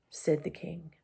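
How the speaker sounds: plain reading voice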